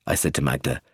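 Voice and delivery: ordinary delivery, nothing marked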